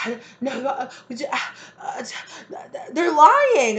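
high, caught-out stammering; the caught liar stammering excuses, high and flustered. Retold or imitated. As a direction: flounder for an excuse, voice climbing. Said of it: "And they're just like".

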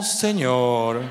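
deep, far-off exasperated Lord; a low groan of despair; weary sigh to God. Retold or imitated. Exclamación exasperada ante los niños. (suspiro exasperado muy grave)

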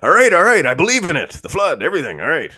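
high, loud frantic backpedalling; mock panicked backpedal. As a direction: beg for mercy in a hammed-up squeal. Mock panicked recanting under threat.

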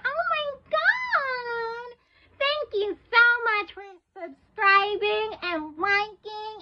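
put-on squeaky-high, drawn-out little kid voice; little kiddy voice. Shown as a demonstration. Vlogger showing off a voice to thank subscribers, little kiddy voice. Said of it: "I'm gonna do my little kitty voice for you guys".

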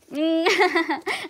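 high, loud nervous giggle; nervous laugh. Real, not acted. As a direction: giggle out your nerves. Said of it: "I'm so nervous".

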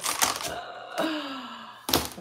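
long, high-pitched sigh; exasperated sigh. Real, not acted. Crafter feels judged cutting on a live, exasperated sigh. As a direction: let out a long, flustered sigh.

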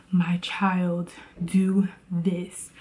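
put-on hushed, voiceless whisper as God speaking to a child. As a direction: whisper it like a gentle divine nudge. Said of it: "He's gonna say".

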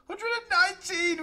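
loud, high-pitched score callout; excited tally. Real, not acted. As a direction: announce the number like a big win. Excited reading of kill counts.

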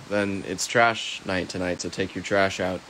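ordinary talk

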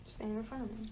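ordinary talk

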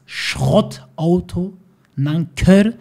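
drawn-out, scornful "Schrottauto"; mocking quote. Husband mocking the wife's word, stretched and scornful. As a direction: stretch "Schrottauto" out slowly in mockery. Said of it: "Und du sagst".

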